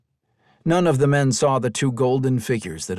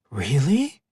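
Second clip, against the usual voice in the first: surprised; 7 semitones higher, 4 dB louder than usual. A narrator's reading. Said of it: "Melina asked in surprise".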